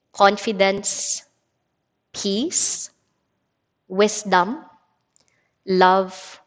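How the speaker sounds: unhurried reading with long pauses between phrases; meditative card reading. Acted. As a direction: let each listed word stand alone, then pause. Rereads the card slowly.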